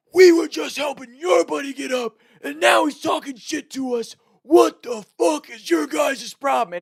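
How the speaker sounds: high, loud imitation of a raging jock; mock rage. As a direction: yell like a frat bro losing it. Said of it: "And it's the typical, like, rage".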